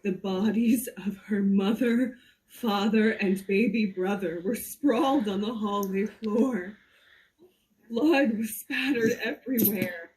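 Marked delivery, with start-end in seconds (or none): none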